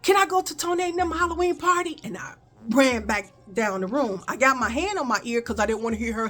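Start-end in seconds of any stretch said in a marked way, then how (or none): 0.0-2.0 calling out. Retold or imitated. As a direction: blurt it out fast and run. Calling out again to mom.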